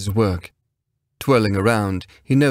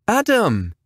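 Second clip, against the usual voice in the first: calling after someone; 13 semitones higher than usual. A narrator's reading. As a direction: shout his name as he leaves. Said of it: "Penn called after him".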